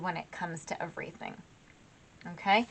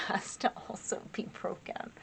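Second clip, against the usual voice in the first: whispered laughing aside; 9 dB quieter than usual. Real, not acted.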